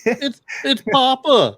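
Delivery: high, slow laughing call; dad calling out. Retold or imitated. Imitating dad calling from car.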